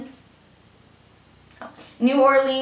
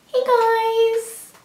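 much higher bubbly vlogger "Hey, guys"; perky greeting; 11 semitones higher than usual. Acted. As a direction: greet your followers all sweet and bright. Acting out a group greeting.

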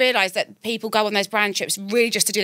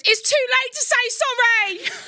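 mock wail; 13 semitones higher than usual. Friend retelling the tearful reply at the airport, mock wailing. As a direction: wail it like a heartbroken lover, hammed up. Retold wailing breakup line.

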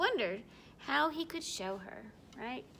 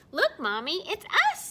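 put-on higher, louder proud kid voice; proudly; 6 semitones higher, 9 dB louder than usual. Snowy showing Mommy the snow bears, proud. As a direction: show it off, bursting with pride. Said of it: "Snowy said proudly".

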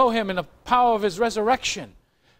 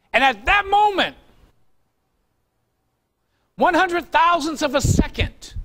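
rising, fervent sermon cry; full-force preaching; 4 semitones higher than usual. Acted. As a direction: preach it out to the congregation. Preaching climax on the rapture.

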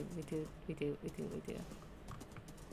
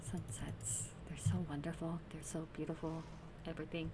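hushed; 4 semitones lower, 15 dB quieter than usual. Real, not acted. Soft hush admiring sunset.

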